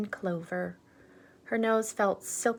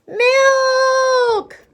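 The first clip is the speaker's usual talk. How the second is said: very high loud wailing plea; begging children; 12 dB louder than usual. Acted. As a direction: cry out like starving kids. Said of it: "they begged".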